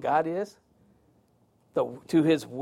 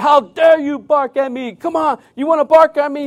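higher, louder mock yell at the dogs; drunk man yelling; 8 semitones higher, 6 dB louder than usual. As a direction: bark it back at the dogs. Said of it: "his conversation changed to them and saying".

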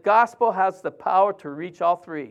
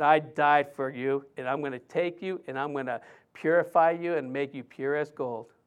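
tender voice of God; 5 semitones lower, 7 dB quieter than usual. Acted.

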